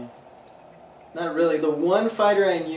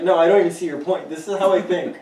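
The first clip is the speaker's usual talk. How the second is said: stubborn mock quote; 4 semitones higher, 8 dB louder than usual. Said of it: "It's like".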